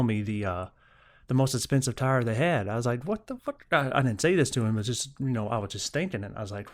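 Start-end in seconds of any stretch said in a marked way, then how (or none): 3.0-3.6 stunned inner reaction. Retold or imitated.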